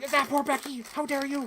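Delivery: high, loud mock indignation; exaggerated streamer imitation. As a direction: cry it out in comic high-pitched outrage. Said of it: "I'm making it sound funnier".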